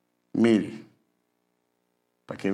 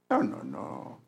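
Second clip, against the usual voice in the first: ordinary talk, nothing marked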